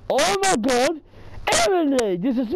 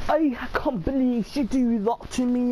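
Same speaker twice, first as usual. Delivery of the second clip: usual speaking voice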